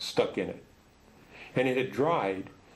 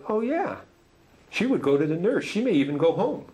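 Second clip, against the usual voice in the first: put-on higher boy's voice, a bit louder and cheerful; boy's matter-of-fact reply; 8 semitones higher, 5 dB louder than usual. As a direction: answer like a kid stating the obvious. Boy voiced answering about girls.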